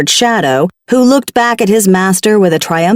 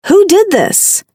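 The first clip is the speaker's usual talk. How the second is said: ordinary delivery, nothing marked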